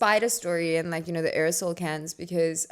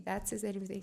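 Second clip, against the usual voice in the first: whisper; 10 dB quieter than usual. Retold or imitated. Secret about the dead tarantula.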